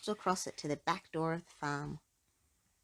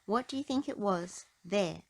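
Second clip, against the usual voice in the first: plain reading voice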